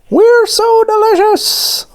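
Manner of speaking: very high, slow squeaky donut holler; shouted back. A narrator's reading. Donuts proudly answer the baker, high drawn-out squeaky shout. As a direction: squeal it out proudly, stretching the words. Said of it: "the donuts shouted back".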